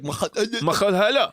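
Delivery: slow, guttural mock Arabic; mock Arabic accent. Comparing Scottish speech to Arabic, guttural mock Arabic. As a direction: imitate Arabic from the back of the throat. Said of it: "that same kinda Arabic".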